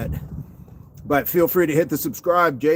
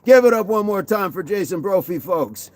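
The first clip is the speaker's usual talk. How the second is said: higher-pitched mock applause call, falling away; comedy club emcee; 4 semitones higher than usual. Host sends off a joking viewer, mock emcee patter. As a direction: whip up the crowd like a club MC.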